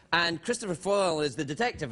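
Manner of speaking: normal talking voice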